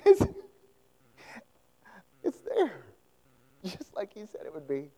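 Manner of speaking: ordinary talk, nothing marked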